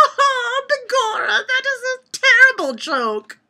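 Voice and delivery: hammed-up Irish accent, pitched high; jokey stage-Irish lilt, begorra and all. Vlogger reading jokes in a put-on Irish accent. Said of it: "I must be doing it in an Irish accent".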